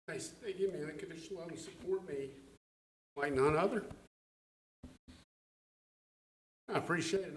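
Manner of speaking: fighting back tears while thanking family; choked up. Real, not acted. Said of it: "I'm sorry to get emotional".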